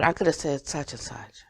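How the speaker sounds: hushed self-talk; whispered realization. Retold or imitated. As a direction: whisper it as a private afterthought. Inner realization, retold thought.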